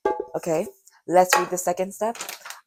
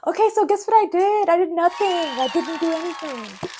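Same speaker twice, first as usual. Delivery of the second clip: much higher, loud dramatic reveal; mock big reveal; 11 semitones higher, 5 dB louder than usual. Real, not acted. Revealing to viewers her empty holiday with mock excitement. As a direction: announce the letdown like big news.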